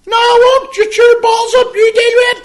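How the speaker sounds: high, loud mock wife voice; voicing his wife shouting back from the other room; mimicking the wife. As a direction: do her as a shrill-ish shouting wife. Wife's retort voiced in story.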